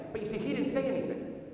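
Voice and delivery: ordinary talk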